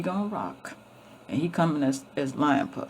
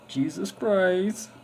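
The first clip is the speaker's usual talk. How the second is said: mocking drawl; 3 dB louder, much slower than usual. Mocking Jesus.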